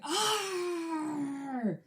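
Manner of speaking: long, high, softened exasperated groan; retold growl of frustration, pitched high; frustrated groan. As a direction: let out a drawn-out mock groan. Acting out own frustrated reaction.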